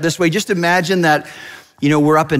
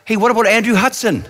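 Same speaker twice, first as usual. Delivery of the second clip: higher, quick call from the back; mildly raised, eager shout-out; mock yell; 9 semitones higher, faster than usual. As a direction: pipe up from the back of the room. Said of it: "someone in the back yells".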